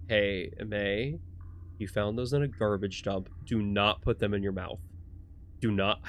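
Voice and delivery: low, soft warning; stern grown-up character. Acted. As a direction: warn a child quietly and firmly. Voices warning game character.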